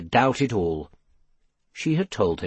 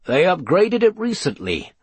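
higher-pitched, mildly grumbled complaint; grumbling; 5 semitones higher than usual. A narrator's reading. Pewter fiddles with the upgraded magical globe, grumbling. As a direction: grumble about an unwanted change. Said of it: "grumbled Pewter".

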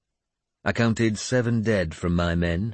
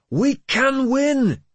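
high, drawn-out battle cry; rallying shout; 5 dB louder, much slower than usual. Acted. Leader shouts to his uncertain men, rallying. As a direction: shout each word slowly to the whole army. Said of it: "Sigtryggur shouted".

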